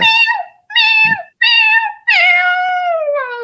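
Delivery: imitated cat meows. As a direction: meow insistently like a cat wanting Daddy. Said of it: "be all like".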